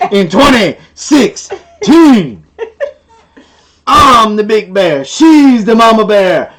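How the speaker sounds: big, slow, drawn-out announcer delivery; loud, punched word by word, building up; hyped show intro. Pretend. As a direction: announce it like a ringmaster, stretched out. Hyped intro of their homestead show.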